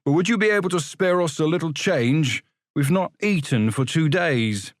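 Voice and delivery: begging a passing clerk for coins, high and pleading; pleading boy. Acted. Boy begging a stranger for change.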